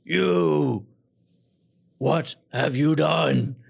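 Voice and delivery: furious bellow, loud and slow; angry lord bellowing at strangers in his manor; roaring lord. Acted. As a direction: roar it at the intruders. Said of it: "he just roars".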